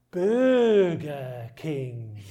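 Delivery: "Burger King" stretched out very slowly, each syllable held; spell-it-out slow delivery of the punchline. Acted. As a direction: drag the name out painfully slowly with a laugh breaking in. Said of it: "Can you tell us very slowly".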